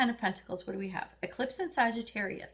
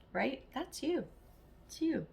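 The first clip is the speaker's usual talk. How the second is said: usual speaking voice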